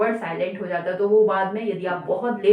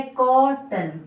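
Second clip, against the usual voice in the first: syllable by syllable; 3 semitones higher, much slower than usual. Shown as a demonstration. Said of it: "next है".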